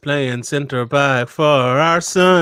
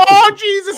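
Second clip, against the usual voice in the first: howling; 7 dB louder than usual. Pretend. Joining in the howling.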